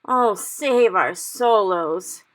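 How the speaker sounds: very slow loud anguished wail; cried. Acted. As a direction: wail it in despair. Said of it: "he cried".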